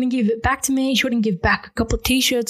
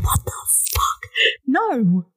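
stunned disbelief; 2 semitones higher, slower than usual. Retold or imitated. Acting out baffled reaction to ex.

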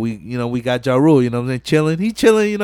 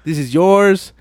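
put-on higher, louder, slow teasing girl voice; 6 semitones higher, 4 dB louder than usual. Girl to Edwin before the race, slow teasing offer. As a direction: purr it out like a tease. Girl promises herself to winner.

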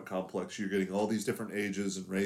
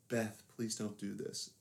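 soft pleading; 11 dB quieter than usual. Retold or imitated. Demon begs sister with sister's face.

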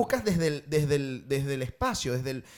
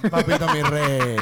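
mock sweet talk; 3 semitones lower, 6 dB louder, slower than usual. Repeats friend's phrase mockingly.

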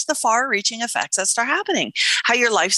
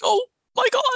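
stunned; 13 semitones higher, faster than usual. Retold or imitated. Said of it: "I'm like".

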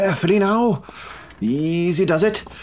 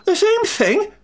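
much higher, indignant repeat; outraged disbelief; slower than usual. Acted. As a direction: shoot up in pitch, utterly affronted. Welsh umpire offended by English jibe.

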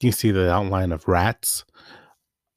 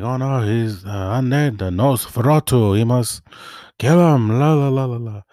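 lightly voiced Van Helsing, rising to a loud, high "kill him"; half-hearted old professor voice, building to a shout; Van Helsing impression; 4 semitones higher, 5 dB louder than usual. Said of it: "I try to do my best impersonation of him".

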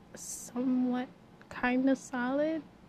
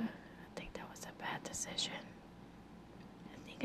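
whispered aside, unvoiced; 15 dB quieter than usual. Real, not acted. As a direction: breathe it out as a sheepish aside. Aside about quitting therapy.

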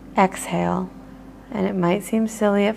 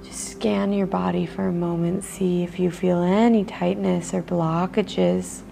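guided meditation; 4 dB quieter than usual. Acted. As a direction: ease the listener into noticing their body. Leads a body-scan meditation.

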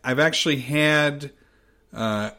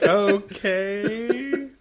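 mock resigned okay; 6 semitones higher than usual. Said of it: "I'm like".

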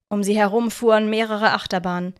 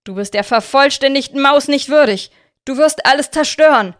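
loud, higher urgent warning; shouting warning; 5 semitones higher, 7 dB louder than usual. A narrator's reading. Aaron shouting a warning at Victor. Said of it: "schrie Aaron".

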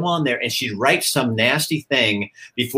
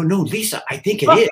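higher, worried insistence; mock alarm; 7 semitones higher than usual. Anchor warning co-anchor about closed captioning, alarmed insistence. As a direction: insist with rising, half-laughing alarm.

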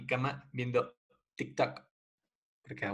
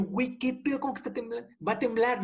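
startled disbelief; 11 semitones higher, faster than usual. Retold or imitated. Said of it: "Y yo".

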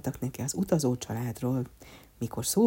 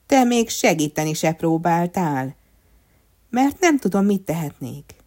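raised, louder argumentative voice; quarrelling; 4 semitones higher, 8 dB louder than usual. Acted. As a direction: argue back in a raised voice. Wooden people quarrelling in the story.

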